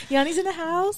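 high hype-man call; announcer hype. Acted. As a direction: announce her like an MC. Hypes friend like an announcer.

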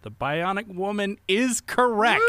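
triumphant quizmaster stretch; game-show announcer. Real, not acted. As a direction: announce the right answer with a slow build. Game host announces right answer.

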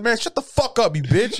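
heated comic retort; retold angry snap. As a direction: snap back with the pitch pushed up. Retold insult in the quarrel.

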